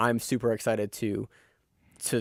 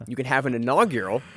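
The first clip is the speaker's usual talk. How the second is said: usual speaking voice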